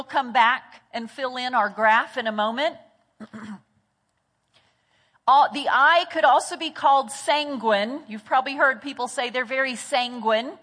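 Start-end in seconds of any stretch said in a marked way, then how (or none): none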